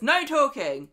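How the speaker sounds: loud, commanding judge's order. Retold or imitated. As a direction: imitate a judge silencing the court. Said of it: "And the judge says".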